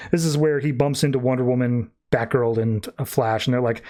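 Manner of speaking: usual speaking voice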